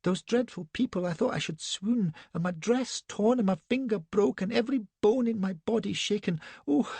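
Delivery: high-pitched distressed lady; Elsbeth's fluttery cry. A narrator's reading. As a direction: play a shaken, fussing young wife. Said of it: "cries she".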